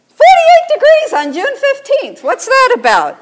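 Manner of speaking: high, loud exclamation of disbelief; indignant disbelief. Real, not acted. Preacher exclaiming to congregation about June weather abroad, indignant disbelief.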